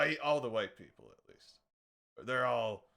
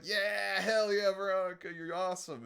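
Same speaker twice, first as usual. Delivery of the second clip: mock cheer; 6 semitones higher, 5 dB louder than usual. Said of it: "being like".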